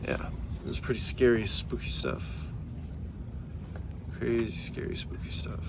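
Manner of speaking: hushed ghost-story whisper; spooky whisper. Acted. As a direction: breathe it like a campfire tale. Spooky remark, drops to whisper.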